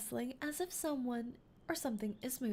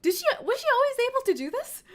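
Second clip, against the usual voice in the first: excited; 14 semitones higher, 9 dB louder, faster than usual. Real, not acted. Sudden realisation.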